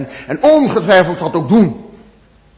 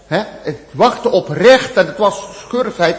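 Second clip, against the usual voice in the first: normal talking voice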